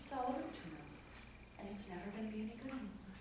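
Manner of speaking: very soft, defeated murmur; hushed, fast resignation; despondent. Acted. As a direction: say it quietly, as if it is all over. Wife near tears, giving up.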